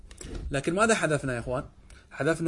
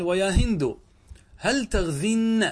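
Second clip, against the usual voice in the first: drawn out, هل stressed and raised; teacher modelling the example slowly for students; slow model sentence; 5 semitones higher, 3 dB louder, slower than usual. Shown as a demonstration. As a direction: stretch "هل تغزين" with weight on هل. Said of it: "قال".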